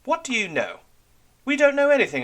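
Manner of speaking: heated sailor arguing; angry retort. Acted. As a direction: push back hard at the bully. Sailor argues with Red Ram.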